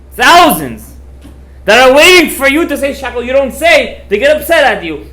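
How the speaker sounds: loud, high-pitched insistence; emphatic exhortation. Retold or imitated. Urgent plea about waiting souls.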